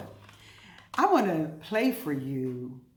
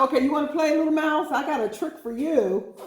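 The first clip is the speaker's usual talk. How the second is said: teasing the mouse, higher and louder; sly challenge; 9 semitones higher, 6 dB louder than usual. Acted. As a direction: taunt the little mouse with a trick ready. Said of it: "She said".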